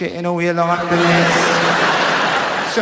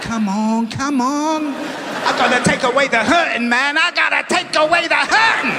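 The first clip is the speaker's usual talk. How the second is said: higher, rising, mock desperate plea; pleading at pub window; 7 semitones higher than usual. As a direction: beg to be let in, hammed up. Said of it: "I used to do that".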